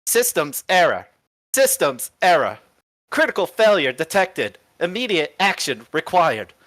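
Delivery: measured automated alarm announcement; robot error alert. Acted. Robot reports a critical failure, automated alert voice. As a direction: repeat each alert in the same falling cadence.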